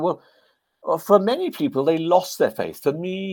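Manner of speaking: high-pitched Welsh lilt; Trevor Bray. Retold or imitated. Veteran explains what happened to faith, imitated Welsh accent. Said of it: "he said in his Welsh accent".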